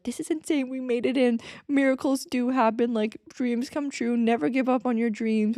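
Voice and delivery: excited disbelief. Retold or imitated. Friends freaking out after sneaking into the party, giddy and excited. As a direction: gush like a dream just came true.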